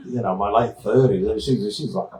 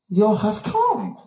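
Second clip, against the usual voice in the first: mock Austrian surprise; 9 semitones higher, much slower than usual. Babsy surprised, slow high.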